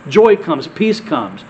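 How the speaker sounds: ordinary talk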